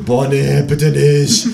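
groaning protest. A narrator's reading. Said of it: "sagen das Gespenst und ich gleichzeitig".

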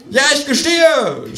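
high, slow mock confession; kangaroo shouting. Kangaroo mock-confessing to the cop, high and slow. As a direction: cry it out high like a hammed-up confession. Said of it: "schreit das Känguru".

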